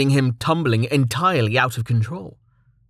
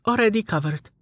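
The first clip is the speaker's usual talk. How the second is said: put-on wry, higher teary girl; Amelia, higher voice; 10 semitones higher than usual. A narrator's reading. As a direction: toss it off dryly. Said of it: "Amelia said".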